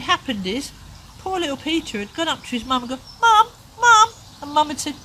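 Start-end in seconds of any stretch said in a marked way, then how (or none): 3.2-4.1 high, loud crying call; a child calling for his mum through tears. Acted. Little boy crying out to his busy mum.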